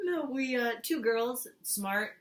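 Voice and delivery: ordinary talk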